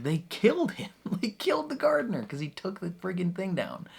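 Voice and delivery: high-pitched, laughing disbelief; incredulous outrage. Real, not acted. Viewer recapping the gardener's death, incredulous outrage. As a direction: squeal it in exasperation, laugh breaking in.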